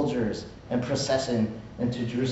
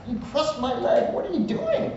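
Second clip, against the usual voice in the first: indignant Balaam; 12 semitones higher than usual. Retold or imitated. Balaam beating his donkey.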